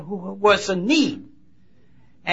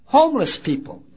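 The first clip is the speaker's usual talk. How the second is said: normal talking voice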